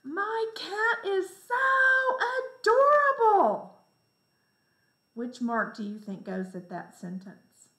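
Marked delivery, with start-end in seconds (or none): 0.0-3.8 very high, loud, slow gushing over the cat; exaggerated, thrilled exclamation for young learners; big exclamation. Shown as a demonstration. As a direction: squeal so adorable like an excited child. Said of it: "listen to my words".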